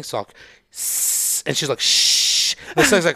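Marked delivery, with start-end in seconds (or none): 0.7-1.4 unvoiced snake hiss. Imitating the rented snake on set, hissing. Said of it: "the snake is hissing". 1.8-2.6 hushing. Retold or imitated. Nicole hushing snake.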